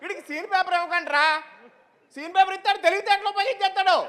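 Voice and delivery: high-pitched comic griping; exasperated director. Acted. Director to crew about the actor, exasperated.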